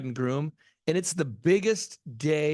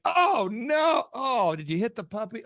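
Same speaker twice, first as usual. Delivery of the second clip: mock alarm; 6 semitones higher, slower than usual. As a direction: act out horrified shock. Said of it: "every week it was like".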